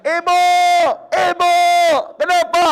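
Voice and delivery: high, loud accusing yell; shouting at mother. Retold or imitated. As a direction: yell at her like you found a mess. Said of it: "Langsung dia teriak".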